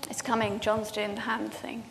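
real whisper behind the hand; a quick private whisper to the room; whispered aside. Real, not acted. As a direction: whisper about the hand signal. Aside about the tech cue.

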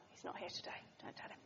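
conspiratorial whisper; whispered aside. Real, not acted. Preacher confiding to the congregation, a playful whisper. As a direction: whisper it like a secret.

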